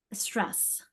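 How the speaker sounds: ordinary talk, nothing marked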